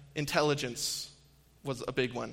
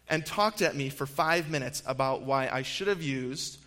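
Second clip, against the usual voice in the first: ordinary delivery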